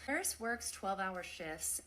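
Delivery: normal talking voice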